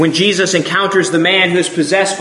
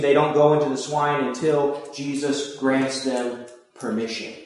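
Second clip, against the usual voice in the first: ordinary talk, nothing marked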